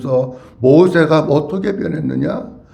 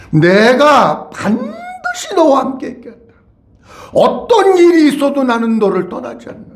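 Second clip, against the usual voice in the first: "반드시" stretched out and pitched high; high-pitched, insistent stress on "반드시" and "끝까지"; emphatic promise; 5 semitones higher than usual. Acted. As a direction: press home "반드시" as an unbreakable vow. Preacher voices God's emphatic promise.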